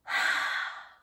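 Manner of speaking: open-mouthed warm exhale onto the hands; a slow voiced haaah; warm breath out. Shown as a demonstration. Teacher shows the kids a warm breath, haaah exhale. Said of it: "That's a warm breath".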